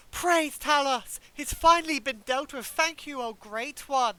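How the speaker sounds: loud, slow, fervent street preacher cry; a worshipper's ecstatic shout of praise; zealous preacher. Acted. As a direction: proclaim it like a fanatic giving thanks. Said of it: "the guy who always is worshipped down goes".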